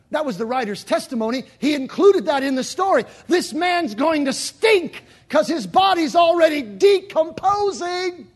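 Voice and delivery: rising preaching. Acted. Preacher stressing the stink of the tomb, rising preaching. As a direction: lean into it, slowing at the end.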